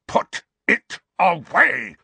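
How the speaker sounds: high, loud snarl with each word slow; angry snarl. A narrator's reading. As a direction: snarl the order slowly and forcefully. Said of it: "Uncle Vernon snarled into Harry's ear".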